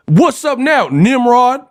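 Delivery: high, challenging movie-gangster call; mock L.A. gangster. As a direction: parody a censored gangster picking a fight. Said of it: "some gangsters from L A, and he's like".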